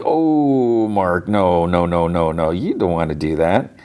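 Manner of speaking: mock-dismayed, talking someone out of it; dismayed pleading. Viewer pleading with Mark not to describe it, dismayed. Teller's own dismayed aside to a character.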